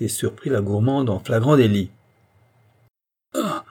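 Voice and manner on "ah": high-pitched startled little "Ah!". A narrator's reading. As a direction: caught in the act, gasp it out high. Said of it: "fit-elle toute saisie".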